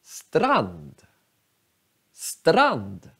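strand, said slowly and clearly as one syllable; teacher models strand for learners; slow model word. Shown as a demonstration. Teacher shows students the word, said clearly. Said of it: "många tycker att det här ordet är svårt".

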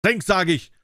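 high, loud bellowed command; roaring order. A narrator's reading. Fiend roaring at the dwarf to drink, loud. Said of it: "brüllte Setz der Unhold".

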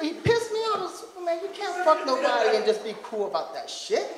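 ordinary delivery

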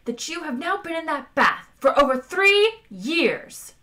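high, loud, indignant rebuke; seething, forceful high delivery; angry hiss. A narrator's reading. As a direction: build outrage at a lazy superior. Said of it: "hissed number two at the captain".

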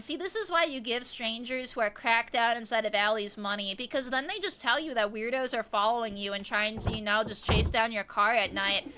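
ordinary talk

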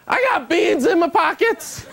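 high, slow, dazed voice; high and slow, a mock crazy man; goofy street stranger. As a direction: play an aimless street oddball.

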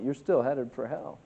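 ordinary talk

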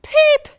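very loud, high peep; blurted peep. A narrator's reading. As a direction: burst out with a squeak. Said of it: "he blurted out".

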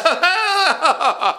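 high-pitched silly giggle; giddy high laugh. Acted. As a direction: cackle with delight.

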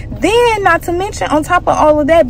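ordinary talk, nothing marked

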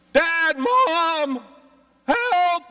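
put-on high frantic child's cry for help; panicked cry. Alone child crying out to parents, panicked. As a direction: scream for mom and dad in panic.

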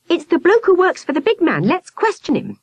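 put-on high, excited girl crying out; eager cry. A narrator's reading. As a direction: rush it out, keen to catch him. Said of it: "Victoria cried".

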